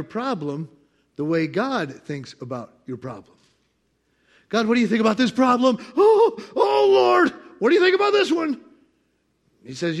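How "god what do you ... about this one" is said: high, loud, fast panicked plea; distressed believer shouting; frantic prayer. Pretend. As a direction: cry out to God in alarm. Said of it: "praying to God".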